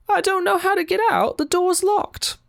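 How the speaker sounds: very high put-on helpless voice; confused old lady. Confused lady telling the stall occupant she's stuck, very high voice. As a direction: squeak it out as a hammed-up confused lady.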